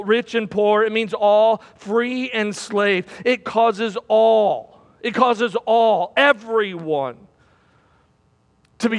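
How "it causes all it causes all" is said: "It causes all" very slow, leaning on "all"; stress on all. Shown as a demonstration. Preacher drilling the verse word into the congregation, slow and heavy on "all". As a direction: drag out each word, landing on "all". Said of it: "underline that word".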